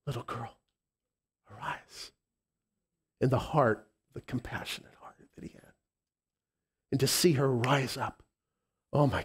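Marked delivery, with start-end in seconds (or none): 0.1-2.1 soft hushed whisper. Acted. Jesus speaking tenderly to girl. 4.1-5.7 whispered. Real, not acted.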